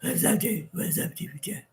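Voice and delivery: low, quiet, husky mock of hubby; a hint of a laid-back husband; imitating hubby's low voice. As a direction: drop into a low mumbled man's greeting. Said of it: "But he goes".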